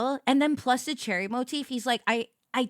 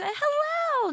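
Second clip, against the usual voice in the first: high, slow singsong hello; slow high greeting. Pretend. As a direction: stretch the hello like a cheerful hostess. Diner host voice again.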